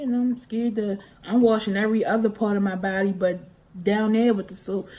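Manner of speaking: ordinary delivery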